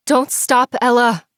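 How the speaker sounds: Don't stop yelled high and loud to Ella. Acted. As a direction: yell Don't stop at her after falling. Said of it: "I yelled".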